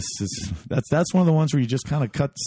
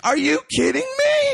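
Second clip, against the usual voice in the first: high, shouted disbelief; mock disbelief. Storyteller reliving the punch, high shouted disbelief. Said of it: "I'm like".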